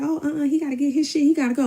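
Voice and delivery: high, insistent mock outrage; indignant retelling. Friend on the phone reacting to him, indignant.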